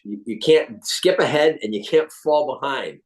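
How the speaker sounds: usual speaking voice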